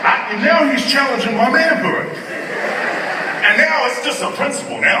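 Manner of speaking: comic high-pitched outrage; mock outrage. Real, not acted. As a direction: act wounded in your pride. Outraged about his manhood.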